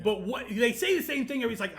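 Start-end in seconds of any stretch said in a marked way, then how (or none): none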